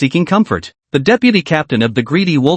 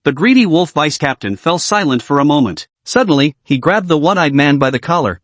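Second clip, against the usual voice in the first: usual speaking voice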